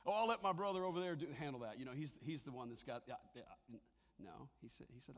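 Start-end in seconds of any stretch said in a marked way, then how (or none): 0.0-1.8 louder, brushing-off excuse; mock churchgoer ducking duty; dodging excuse. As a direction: pass the buck with a shrug. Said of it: "he didn't say".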